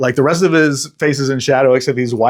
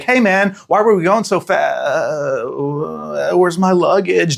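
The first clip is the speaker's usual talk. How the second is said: confused Renfield; 4 semitones higher, slower than usual. Retold or imitated.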